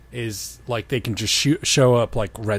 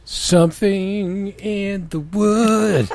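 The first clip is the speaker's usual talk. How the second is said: slow, hammed-up eerie voice; spooky; 7 semitones higher, much slower than usual. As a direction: drag it out like a ghost story. Spooky slow echo of title.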